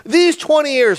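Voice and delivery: high, loud acted outburst; pent-up frustration. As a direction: burst out with old grievance. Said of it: "twenty years of frustration is about to come out".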